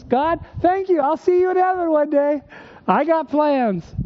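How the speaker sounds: high, eager and breezy, brushing God off; cocky young believer. Retold or imitated. Young self telling God his plans, carefree. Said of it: "I said, in essence".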